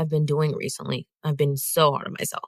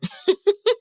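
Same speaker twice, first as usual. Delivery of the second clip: laugh; 14 semitones higher than usual. Real, not acted. Laughing at the bubble chaos.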